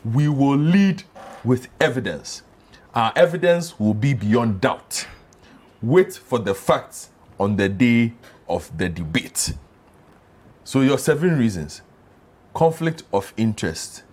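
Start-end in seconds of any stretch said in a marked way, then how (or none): 0.0-9.5 slow, deliberate reading of a pledge; measured, emphatic quote with pauses; emphatic quotation. Retold or imitated. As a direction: quote it weightily, stretching out the phrases.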